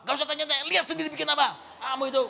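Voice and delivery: loud, high furious scolding; angry mother. Acted. Mother snapping at her child's question, furious. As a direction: yell at the child in acted fury. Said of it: "Mamanya marah".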